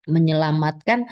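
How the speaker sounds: ordinary talk, nothing marked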